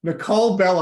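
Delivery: stumbling over the name; tripping on the words, laughing; stuttering. Real, not acted. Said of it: "I'm stuttering here".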